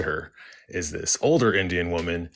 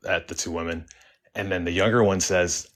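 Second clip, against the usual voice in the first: plain reading voice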